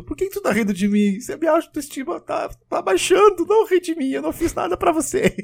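very high, whining mock protest; hammed-up, high-pitched pleading; hurt coworker. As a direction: squeal like someone wounded at being laughed at. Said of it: "ela fala assim".

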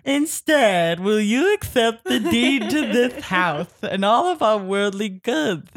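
silly high, loud, drawn-out reading voice; hammed-up mock character voice, stretching the words; Jessica. Host mimicking a co-reader's unserious read-aloud, silly and exaggerated. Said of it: "her voice would be like".

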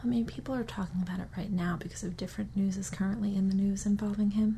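hushed gossip whisper. Real, not acted. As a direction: whisper it like a secret. Secret gossip about scandal.

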